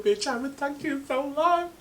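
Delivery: high, whiny fake crying; mock-tearful. Woman pretending to call her homegirl, mock-tearful. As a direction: pretend to wail from missing a friend.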